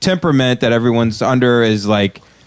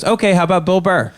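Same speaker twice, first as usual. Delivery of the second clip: higher, hopeful pitch; mock clueless comic; 8 semitones higher than usual. Bombing comic misses the burn, higher and hopeful. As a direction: offer it cheerfully. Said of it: "And he goes".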